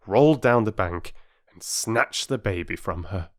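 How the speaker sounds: plain reading voice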